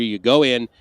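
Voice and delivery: ordinary talk, nothing marked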